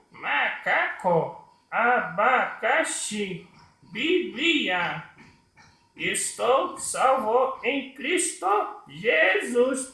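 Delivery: very high puppet, each syllable drawn out; slow puppet speech, syllable by syllable; syllabic puppet voice. Shown as a demonstration. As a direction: pipe it up high and separate every syllable. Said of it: "ele fala silabicamente".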